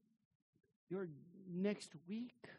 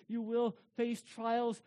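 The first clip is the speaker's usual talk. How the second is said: usual speaking voice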